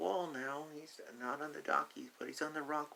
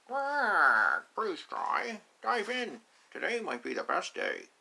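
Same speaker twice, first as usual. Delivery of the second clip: louder, higher duck dad urging; a duck father cheering his child into the water; loud quacking papa duck; 4 semitones higher, 8 dB louder, slower than usual. Acted. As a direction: quack and push the little one to dive. Father line redone, louder.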